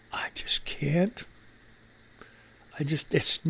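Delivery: breathy, drained whisper under his breath; hushed, beaten-down murmur; defeated whisper. Real, not acted. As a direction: give up in a whisper. Host sinks into despair over likes.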